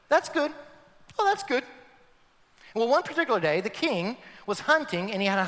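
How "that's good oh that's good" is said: lightly voiced chirpy advisor, higher; a hint of a cheerful courtier; cheery advisor. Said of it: "he'd go".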